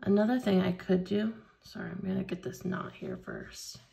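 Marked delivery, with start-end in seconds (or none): none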